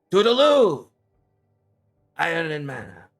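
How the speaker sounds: high singsong holler, easing off; Sam's called farewell. Acted. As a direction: call a loud, high goodbye from a departing boat. Sam calls farewell from departing boat.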